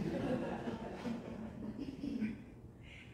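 long high laugh; real laughter at the memory. Real, not acted. Preacher to congregation after son's quote, laughing.

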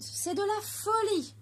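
high alarmed shout; crying out. A narrator's reading. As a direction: cry out to stop a reckless friend. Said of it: "crièrent les autres".

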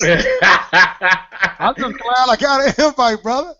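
slow, laughing through the words. Real, not acted. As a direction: chuckle as you repeat it. Guest repeats line laughing.

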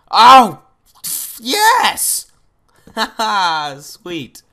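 real joy at spotting it; excited yell. Real, not acted. Gamer finding the ravine he wanted, thrilled shout.

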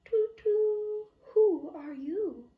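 high hooting owl voice, toot toot. Acted. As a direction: hoot it out like a friendly owl. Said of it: "hooted the owl".

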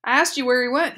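a commandant repeating a question, clear and firm. A narrator's reading. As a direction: ask it again, crisply and clearly. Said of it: "perfectly clear voice".

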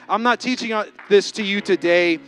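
loud, rising sermon voice with heavy emphasis; full-force preaching. Acted. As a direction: preach it out to the congregation.

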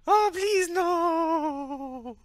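very high, loud pleading wail; terrified wail. Acted. Told he might kill them, panicked high wail. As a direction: wail it out, drawing out the NO. Terrified plea on hearing they may die.

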